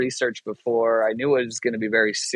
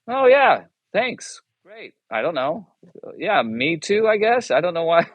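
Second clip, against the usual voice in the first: much higher, sheepish mock thanks; mock awkward gratitude; 7 semitones higher than usual. Retelling the chaplain's call, a high sheepish mock thanks. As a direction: squeak out an embarrassed thank-you. His own mock reaction to the praise.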